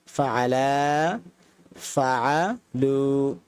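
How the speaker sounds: ফাআলা then ফাআলু, both very slow and drawn; slow contrast drill. Shown as a demonstration. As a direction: set ফাআলা against ফাআলু slowly. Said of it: "ফাআলা তারা দুজন".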